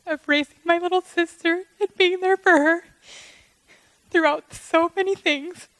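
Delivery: holding it together through rising emotion; fighting back tears. Real, not acted. Sister thanking the wedding crowd, voice tight with tears. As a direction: voice straining high as tears come. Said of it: "I'm gonna try really hard not to cry right now".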